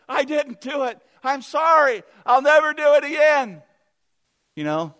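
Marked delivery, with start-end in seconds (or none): 0.1-3.6 loud, high desperate pleading; begging for mercy. Acted. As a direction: beg like a prisoner on the floor. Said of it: "he begins to beg for mercy".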